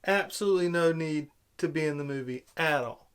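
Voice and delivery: ordinary delivery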